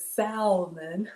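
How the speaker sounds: "salmon" slow and rising, L sounded, SAL-mun. Retold or imitated. Host reading Whitney's vote, salmon with the L. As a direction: lean on the L in "salmon". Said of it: "If you pronounce salmon".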